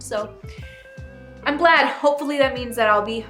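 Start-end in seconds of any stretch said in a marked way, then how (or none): none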